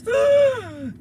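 very high, loud mock gasp; exaggerated scandalized gasp; shocked gasp. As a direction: gasp as a horrified grandmother. Grandma's shocked reaction acted again.

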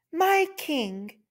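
high, gentle address; bow your voice to the king; humble ant. Acted. The ant addresses the lion king, high and humble.

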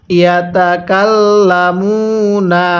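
slow, sustained Quran syllables; measured, chanted teaching recitation; model recitation. Shown as a demonstration. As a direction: hold the long vowels for learners. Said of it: "এরকমভাবে শিখব".

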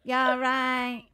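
very nasal Grimsby accent. Shown as a demonstration. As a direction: say it through the nose in a Grimsby accent. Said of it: "It's very nasal".

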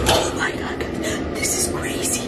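breathy, softened whisper of disbelief; urgent whisper. Acted. Vlogger hidden in the bathroom, urgent whisper.

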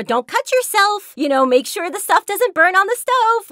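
Jenny's voice. Acted. Jenny warning kids in the kitchen game, high and cheerful. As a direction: chirp the kitchen safety tips like a friendly guide. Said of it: "Jenny, and she does talk like that".

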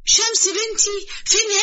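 put-on high demanding old mother; high, insistent old mother asking where her daughter is; high old woman.

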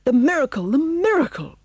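high, awed exclamation; rising excitement, pitched well up; gasped. A narrator's reading. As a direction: gasp it out in amazed relief. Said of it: "gasped Pongo".

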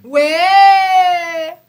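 high, long drawn-out "where"; loud disbelief. Real, not acted. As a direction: challenge the claim with a stretched, loud cry. Disbelieves his claim about Nigeria.

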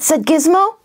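ordinary delivery, nothing marked

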